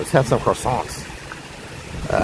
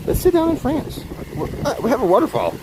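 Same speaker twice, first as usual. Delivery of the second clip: squeaky high voice; 10 semitones higher than usual. Pretend. Repeats it, even higher.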